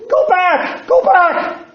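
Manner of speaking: high desperate screaming warning; drowning boy's piercing cry of go back; screamed warning. Retold or imitated. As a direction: scream it to stop your friend. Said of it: "and he screamed".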